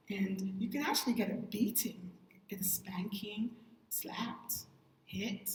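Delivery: unvoiced whispered aside; hushed whisper about punishment. Real, not acted. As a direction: drop to a confiding whisper. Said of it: "whispered aside".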